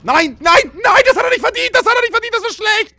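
very high, loud cries of protest; shrill protest. Real, not acted. Gamer wails at an unfair death, very high and loud. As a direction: wail that it wasn't deserved.

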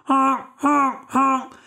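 high loud honking; wild goose honks. Acted. Goose honks wildly after the crash, high loud. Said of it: "honking wildly".

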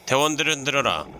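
quick loud command repeat. Pretend. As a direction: rattle off the order again. Mock military squad orders.

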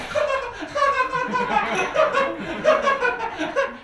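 very high hammed-up forced cackle; mock laugh therapy cackle. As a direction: fake a maniac laughing group. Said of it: "everyone's like".

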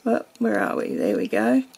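ordinary talk

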